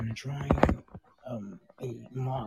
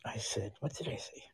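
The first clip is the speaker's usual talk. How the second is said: whispering; 4 dB quieter than usual. Real, not acted. Whispers while streaming at night.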